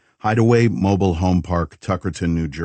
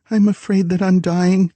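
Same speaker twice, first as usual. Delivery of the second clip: frightened confession; 13 semitones higher, 3 dB louder than usual. A narrator's reading. Frightened woman confesses to shadow man.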